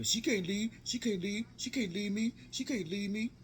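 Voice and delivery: high, repetitive rising whine; imitated possessive insistence. Uncle insisting his niece can't leave, imitated insistence.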